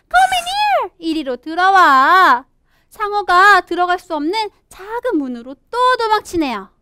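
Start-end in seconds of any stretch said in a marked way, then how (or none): 0.1-2.4 little fish calling a friend inside; urgent calling. Acted.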